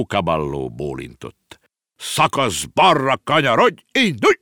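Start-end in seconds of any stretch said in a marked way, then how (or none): 2.0-4.4 slow, much higher and louder drill-sergeant orders; barking commands. A narrator's reading. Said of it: "vezényelt a bácsi".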